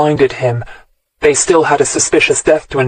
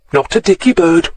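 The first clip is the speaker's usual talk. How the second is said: put-on lilting Welsh accent, higher; Welsh sing-song; 3 semitones higher than usual. A narrator's reading. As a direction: Welsh artist savouring the details. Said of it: "his sing-song voice".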